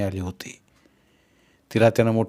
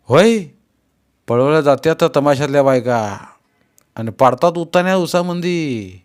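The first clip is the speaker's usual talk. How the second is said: loud outburst; 4 semitones higher, 5 dB louder than usual. A narrator's reading. Heated outburst about tamasha.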